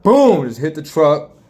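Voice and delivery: sudden loud high shout of boom; crash boom. Retold or imitated. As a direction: blast the impact like a crash. Crash hits the truck.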